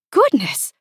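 high-pitched startled exclamation; taken aback by alarming news. A narrator's reading. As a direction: exclaim it in shock.

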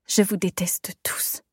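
slow, hissed whisper of hatred; bitter whisper. Acted. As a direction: spit it out under your breath. Pain turns to anger.

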